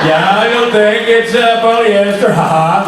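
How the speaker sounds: put-on slow, laughing, lightly done Norwegian accent; mock Norwegian accent. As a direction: play a smug Norwegian, slow and amused. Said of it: "That was really good".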